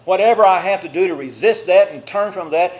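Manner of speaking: loud, defiant refusal; firm shout. Pretend. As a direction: shout down the temptation. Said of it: "we don't stop and say".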